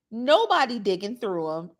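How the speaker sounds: ordinary delivery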